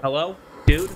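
ordinary talk, nothing marked

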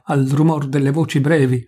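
usual speaking voice

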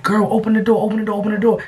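high urgent repeated plea; urgent pleading. Retold or imitated. Girlfriend urging him to open the door, high and urgent. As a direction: keep begging her to open up.

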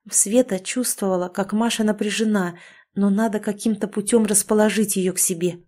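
usual speaking voice

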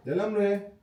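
ordinary talk

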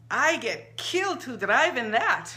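put-on accented woman, a little frightened; Rosa's worried voice. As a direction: voice a nervous shopkeeper fretting at the window. Rosa fearful of the storm.

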